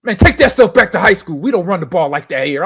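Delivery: loud, high mock bellow; retold taunt. Lineman sending freshman back to high school, imitated loud taunt. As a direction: scold a rookie, imitating the lineman.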